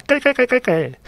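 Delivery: a light impression of a neighbour mom's mocking giggle; mocking cackle. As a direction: retell her little "kkalkkal" laugh as a mock. Mom laughing at dead dog.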